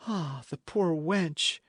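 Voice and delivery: put-on slow, drawn-out lament for the girl; pitying cry. A narrator's reading. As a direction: sigh it out with pity. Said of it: "cried the other".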